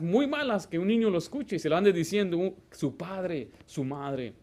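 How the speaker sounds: ordinary delivery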